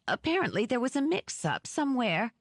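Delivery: a mild frightened whimper; fading to a whimper. A narrator's reading. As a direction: let the voice die in fear. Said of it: "voice petered out to a whimper".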